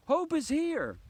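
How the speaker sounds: high, loud squeal of delight; excited discovery. Acted. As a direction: shout it like a child who just spotted her. Child spots the bird.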